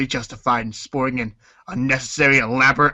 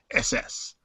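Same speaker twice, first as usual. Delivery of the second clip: breathy under-the-breath whisper; whispered aside; 7 dB quieter than usual. Pretend. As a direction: drop it to a whisper.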